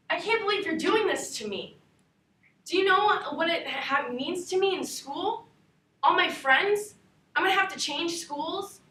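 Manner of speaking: put-on a teenage girl pleading with her parents as they split up; hurt daughter begging then blowing up; upset daughter. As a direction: play the child hearing her parents divorce, from disbelief to anger. Acted monologue of divorce scene.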